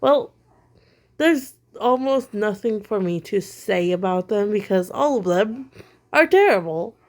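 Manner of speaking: ordinary talk, nothing marked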